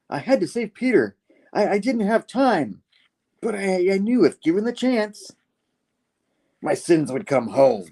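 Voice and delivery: high-pitched acted pleading; anguished justifying. Norman telling the goblin he saved Peter, high pleading. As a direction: plead the character's case, voice pitched far up.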